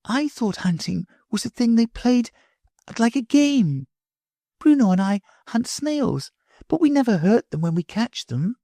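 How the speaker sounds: put-on a little girl hurrying off; high child's voice; little girl Sylvie. Sylvie puzzled about hunting.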